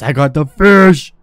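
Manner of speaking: loud burst of victory; triumphant yell. Real, not acted. Gamer celebrating a caught fish, triumphant yell. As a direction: crow loudly over your catch.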